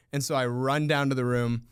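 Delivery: ordinary talk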